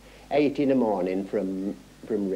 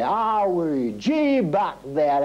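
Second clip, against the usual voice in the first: drawn-out, higher-pitched call to the horses; mock holler, stretched out long without real volume; imitated carters' shouting; 5 semitones higher, much slower than usual. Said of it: "They were just shouting all the way".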